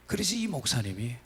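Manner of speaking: usual speaking voice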